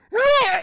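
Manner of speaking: loud, high mock death rattle; hammed-up dying croak; sudden strangled last-breath noise; death cry. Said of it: "And he dies".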